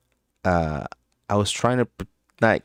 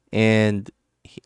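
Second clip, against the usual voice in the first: ordinary talk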